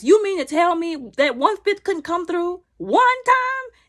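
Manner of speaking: slow, high, loud disbelief; incredulous. Real, not acted. Viewer wanting him to shoot himself, incredulous. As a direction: stretch it out in exasperated amazement.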